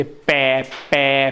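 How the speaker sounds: पै popped firmly off the lips; showing the lip consonant; lip sound demo. Shown as a demonstration. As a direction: press the lips and release पै. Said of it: "बिना होठों के इन्हें नहीं बोला जा सकता".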